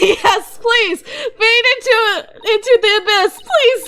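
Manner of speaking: laughing begging; laughing pleas. Real, not acted. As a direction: beg the screen while laughing. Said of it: "I laughed so hard I started crying".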